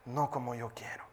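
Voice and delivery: whispered end of prayer. Acted. Believer yielding to God in a whisper. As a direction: breathe it out in a whisper.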